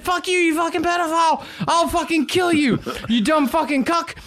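high, squeaky puppet voice; Pinocchio voice. Acted. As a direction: snarl it like an angry little puppet. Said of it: "And Pinocchio said".

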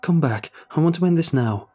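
loud, high, urgent plea; shouted. A narrator's reading. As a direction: shout for rescue. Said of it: "I shouted".

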